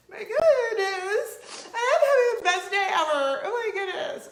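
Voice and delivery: high, loud, tearful rapture; so dramatic. Retold or imitated. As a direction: gush like it's all too much. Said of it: "that was so dramatic".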